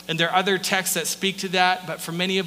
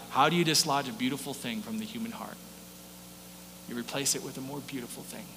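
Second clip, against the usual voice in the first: hushed quote; 7 semitones lower, 11 dB quieter than usual. Retold or imitated. Quoting Puritans softly.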